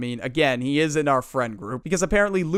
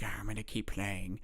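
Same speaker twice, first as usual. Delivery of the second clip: sneaky mutter; 7 semitones lower, 14 dB quieter than usual. Retold or imitated. Kid plotting sneakily.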